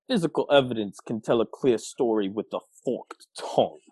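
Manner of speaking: put-on low Southern detective accent; Blanc's Southern accent. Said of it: "I'm gonna try to do the accent again".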